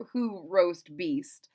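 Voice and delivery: usual speaking voice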